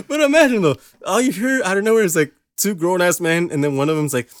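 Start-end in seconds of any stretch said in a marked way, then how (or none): none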